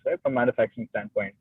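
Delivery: ordinary talk, nothing marked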